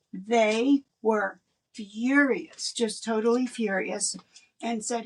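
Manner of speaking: usual speaking voice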